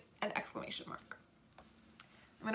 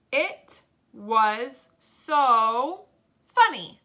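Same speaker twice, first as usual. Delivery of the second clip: very slow, louder, drawn-out words; word-by-word dictation; 7 dB louder, much slower than usual. Shown as a demonstration. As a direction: dictate slowly, stretching each word.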